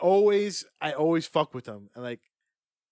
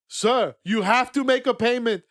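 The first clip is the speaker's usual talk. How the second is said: put-on Indian accent; 5 semitones higher, 6 dB louder than usual. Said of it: "I don't wanna do the accent".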